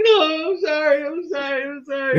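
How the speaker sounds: high, frantic squealing apology; mock-hysterical high-pitched sorry; panicked apology. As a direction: beg forgiveness in a panicked squeal.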